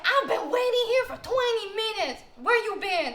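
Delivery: mock the driver's indignant whine; annoyed cab driver.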